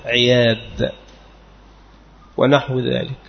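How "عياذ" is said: عياذ as ʕiyādh, kasra on ع, single y, final dh; careful pronunciation. Shown as a demonstration. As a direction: say عياذ slowly, ending on a clear ذ. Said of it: "بكسر العين المهملة وتخفيف المثنات التحتية وآخره ذال معجمة". (عِياذ بكسر العين وتخفيف الياء وذال في آخره)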